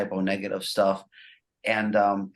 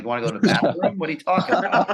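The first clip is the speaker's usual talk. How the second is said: mock confusion; 8 semitones higher, 5 dB louder, faster than usual. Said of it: "I'm like".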